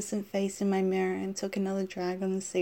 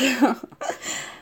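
high breathy giggle; Marilyn Monroe giggle; 4 semitones higher than usual. Acted. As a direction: giggle like a movie siren. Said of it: "I giggled in Monroe's breathy voice".

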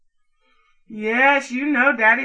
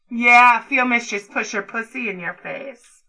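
ordinary delivery

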